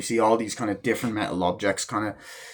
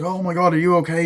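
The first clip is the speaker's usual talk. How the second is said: higher-pitched mock worry; acted alarm; 6 semitones higher than usual. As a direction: imitate a woman rushing in alarmed. Acts woman alarmed at injury.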